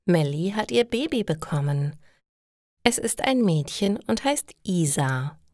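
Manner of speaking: ordinary talk, nothing marked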